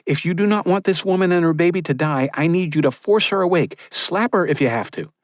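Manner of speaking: urgent midwife. Acted. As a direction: keep the mother awake, pleading. Maria voiced by narrator.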